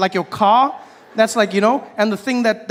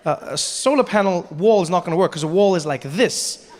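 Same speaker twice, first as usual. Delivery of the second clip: ordinary talk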